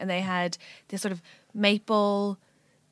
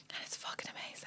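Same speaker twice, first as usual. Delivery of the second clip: hushed whisper; 21 dB quieter than usual. Real, not acted. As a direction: breathe it out in a whisper. Aside about mum's pudding.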